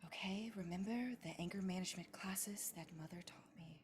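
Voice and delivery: whispered self-calming reminder; whispered to herself. Acted. Dia whispers to herself to calm her anger, hushed. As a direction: whisper it to yourself, reining in anger. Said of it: "talking to herself".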